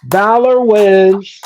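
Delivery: drawn-out winner announcement; slow victory call. Acted.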